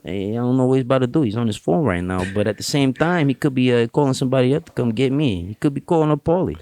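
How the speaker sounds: a hint of a nervous New York wiseguy sizing up the room; New York accent. Shown as a demonstration. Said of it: "New York accent over here".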